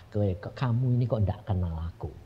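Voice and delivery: low, soft retold voice; God's gentle questioning. God asking the man to trust, soft and low. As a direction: ask quietly and low, with patience.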